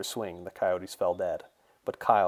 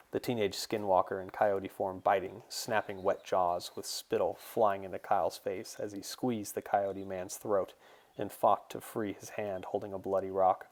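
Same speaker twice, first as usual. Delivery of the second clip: ordinary delivery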